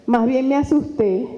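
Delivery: ordinary talk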